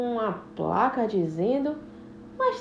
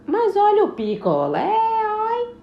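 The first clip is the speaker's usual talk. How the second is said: louder cry rising to oi; singsong beach vendor call; 10 semitones higher, 4 dB louder than usual. Acted. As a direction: sell it to the passerby. Vendor calling again.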